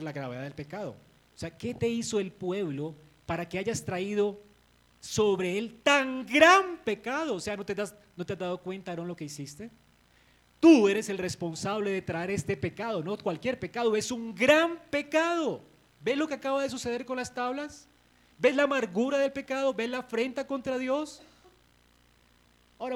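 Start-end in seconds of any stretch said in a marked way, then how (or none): 1.6-7.4 rising into a loud, slow, higher rebuke; voicing Moses' rebuke. Moses rebuking his brother Aaron over the calf, stern and loud. 10.6-21.2 loud, higher stern rebuke; voicing Moses' stern rebuke. Moses confronting Aaron over the great sin, loud and stern.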